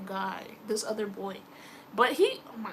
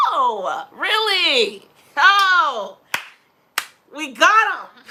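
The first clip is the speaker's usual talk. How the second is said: loud, drawn-out mock astonishment; big, booming acted reaction; gleeful gotcha reaction; 5 semitones higher, 13 dB louder, much slower than usual. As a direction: crow it loudly, like catching someone out. Acting gleeful reaction to friend's news.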